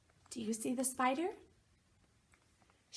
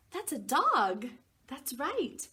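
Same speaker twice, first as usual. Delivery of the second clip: plain reading voice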